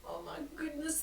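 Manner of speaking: ordinary delivery, nothing marked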